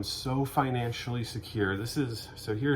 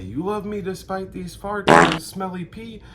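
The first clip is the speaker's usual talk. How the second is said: much higher and louder astonished question; amazed; 9 semitones higher, 9 dB louder than usual. Retold or imitated. Husband amazed his wife still loves him, astonished. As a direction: gush in disbelief at her love.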